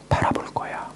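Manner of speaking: fading to a whispered resolve. Pretend. Preacher voicing a believer resolving under trial, whispered. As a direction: breathe 바라볼 거야 like a private vow.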